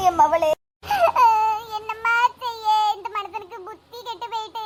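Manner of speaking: hammed-up tearful lament; acted wailing. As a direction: wail like a distraught mother. Acted mother wailing over daughter.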